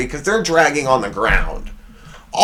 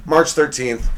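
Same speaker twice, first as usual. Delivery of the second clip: ordinary talk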